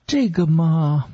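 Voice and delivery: stall, stretching the words out; hesitant. A narrator's reading. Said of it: "此时这女人显出来有些犹豫".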